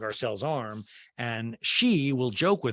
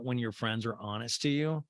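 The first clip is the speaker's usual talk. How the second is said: ordinary talk, nothing marked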